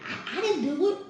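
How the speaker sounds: high, stammering mock-scared voice; lightly hammed-up panicked denial. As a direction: stutter it out like a frightened kid.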